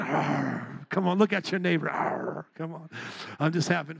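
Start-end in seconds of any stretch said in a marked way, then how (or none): 0.0-0.9 low growl like a lion; low, softer mock lion roar. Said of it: "come out like a lion". 1.9-2.5 low, soft lion growl; short mock lion roar, pitched down. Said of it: "come out like a lion".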